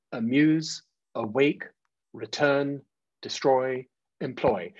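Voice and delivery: "amuse, awake, return, destroy, employ" each with second syllable leaned on; slightly exaggerated stress. Shown as a demonstration. Teacher gives examples of iambic words, slightly exaggerated stress on each second syllable. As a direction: list "amuse, awake, return, destroy, employ" spaced apart, short-LONG. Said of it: "saying it in that slightly exaggerated way".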